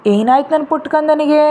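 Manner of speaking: put-on a lilting, tender young mother's voice; sweet voice. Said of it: "ಒಂದ್ ಮಧುರವಾದ ಧ್ವನಿ".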